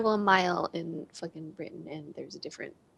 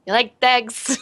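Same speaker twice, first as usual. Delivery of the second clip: put-on thick, loud film-style Pikey accent; 2 semitones higher, 7 dB louder, slower than usual. Player quoting the film pikeys to friends, thick Pikey accent. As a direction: imitate the garbled Pikey brogue. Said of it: "speak like the guys in".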